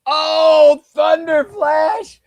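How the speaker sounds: high, loud drawn-out lament; dismayed cry. Real, not acted. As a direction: stretch the name slowly in dismay. Groan as friend eliminated.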